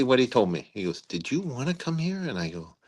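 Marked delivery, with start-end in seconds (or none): 1.0-2.2 softer, much higher, rising disbelief; puzzled question. Retold or imitated. Puzzled general questioning an officer at dinner, softly incredulous. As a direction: quote him baffled, voice lifted and hushed. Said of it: "with a puzzled look in his eye".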